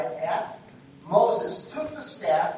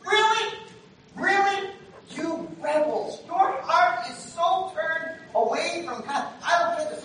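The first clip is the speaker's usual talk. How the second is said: higher, louder, slow angry scolding; angry rebuke; 9 semitones higher, 7 dB louder, slower than usual. Retold or imitated. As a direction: lash out at the crowd, slow and loud. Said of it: "So he's really, really angry".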